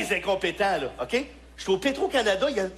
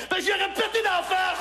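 furious shout; 11 semitones higher than usual. Real, not acted. Comic shouts the punchline to the audience, furious. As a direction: explode at the crowd.

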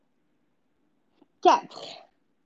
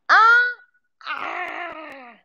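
triumphant shout; 2 semitones higher, 4 dB louder than usual. Real, not acted. Final count, release shout.

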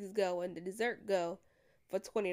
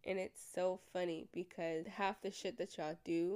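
normal talking voice

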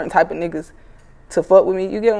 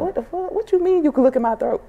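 mock disbelief; 9 semitones higher, 3 dB quieter than usual. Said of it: "I was like".

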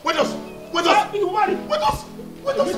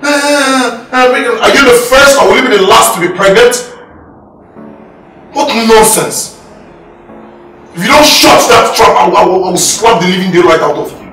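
loud, raised-pitch threatening rant; angry scolding; 4 semitones higher, 8 dB louder than usual. Acted. Husband telling pregnant wife to shut up, angry shouting.

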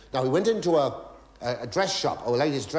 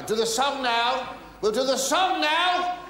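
higher, slower, spelled-out repeat; raised repeat; 11 semitones higher, slower than usual. Pretend. Comedian repeating to the deaf pianist, higher and slower. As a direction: say it again so she hears.

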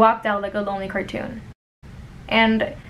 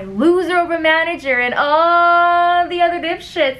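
exasperated; 5 semitones higher, 3 dB louder than usual. Acted. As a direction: vent in disgust at everyone at work. Exasperated rant about coworkers.

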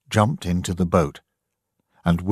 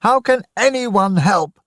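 despairing shout; 4 dB louder than usual. Acted. Daniel's despairing shout continues.

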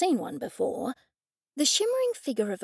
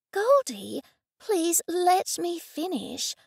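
pleading; 7 semitones higher, slower than usual. A narrator's reading. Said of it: "Her tone was pleading".

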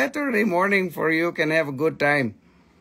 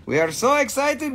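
usual speaking voice

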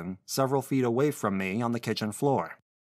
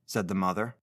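ordinary delivery

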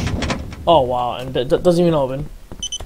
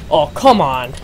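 ordinary talk

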